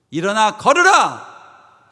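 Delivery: high, loud, slow proclamation; ringing acted command; commanding shout. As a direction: order a lame man to his feet. Acts Peter commanding lame man.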